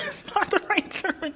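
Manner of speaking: a flustered laugh through words; embarrassed laugh. Real, not acted. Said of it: "if I sound like I'm stumbling".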